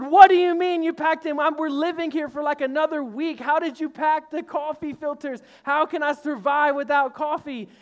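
mock outrage over the packed filters; husband protesting to wife, loud and exasperated; frustrated outburst. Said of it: "I got so frustrated".

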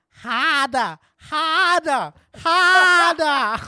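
hammed-up Boston accent, harder dropping the r; building mock Boston moan.

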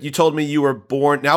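usual speaking voice

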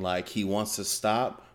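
normal talking voice

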